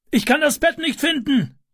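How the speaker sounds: very high, urgent call. A narrator's reading. Man at the smoky window calls down. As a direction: send the voice down to people below on a high pitch. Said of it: "rief er nach unten".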